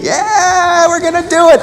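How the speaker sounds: loud, high pumped-up cheer; high excited crowd yell; mock rallying cry. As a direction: cheer like a crowd charging ahead. Crowd running down the street.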